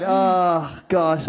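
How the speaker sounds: normal talking voice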